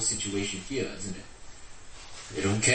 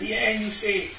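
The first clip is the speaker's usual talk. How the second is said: high, incredulous retort, slowing at the end; indignant Micah; 9 semitones higher, slower than usual. Retold or imitated. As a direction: scoff at the robbers, much higher. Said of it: "And he says".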